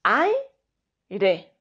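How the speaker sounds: aire with ai in one beat; smooth two-syllable aire; correct. Shown as a demonstration. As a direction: glide ai together in aire.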